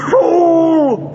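high, loud enraged shriek; a master raging at his failed servant; furious scream. Acted. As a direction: spit out the insult in rage. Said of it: "screamed Greel".

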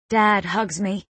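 plain reading voice

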